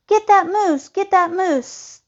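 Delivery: high, urgent hunter's call. A narrator's reading. Hunter calls the others onto the moose, urgent calling. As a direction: call it out high, stretching the repeat. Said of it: "Thidwick heard a voice call".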